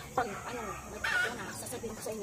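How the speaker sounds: hushed, softly spoken instructions; whispering while hiding. Real, not acted. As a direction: murmur so you stay hidden. Hiding, waiting for passer-by.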